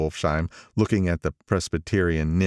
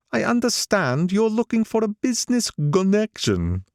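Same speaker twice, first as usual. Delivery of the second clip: put-on higher, nostalgic old man; old gangster voice; 14 semitones higher than usual. Old gangster speaking to the narrator over lunch, higher voice. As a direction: pitch him up as the old racketeer.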